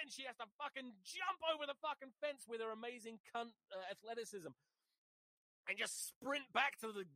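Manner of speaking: ordinary talk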